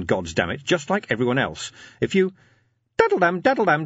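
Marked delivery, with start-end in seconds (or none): 2.0-2.3 chime imitation. Imp's ringing chime. 3.0-3.9 high sing-song daddle-dam, daddle-dam; sung ringtone chime. Acted. Imp's ringing chime.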